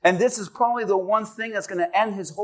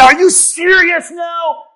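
loud, high, indignant protest; acted-out angry tirade; 6 semitones higher, 8 dB louder than usual. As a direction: rail at God, fed up and incredulous. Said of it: "Some of you would be angry".

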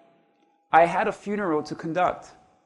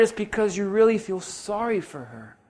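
gentle inner voice; 7 dB quieter than usual. Retold or imitated. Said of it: "the Lord just put in my spirit".

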